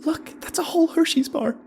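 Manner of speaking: very high, squeaky delight; high-pitched excited squeal; excited child. A narrator's reading. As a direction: marvel like a thrilled kid. Excited child admires candy haul.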